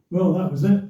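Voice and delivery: usual speaking voice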